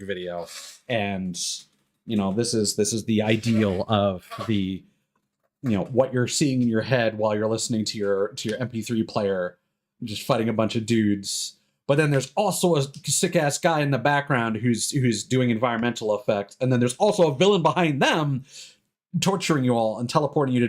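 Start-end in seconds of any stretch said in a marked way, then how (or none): none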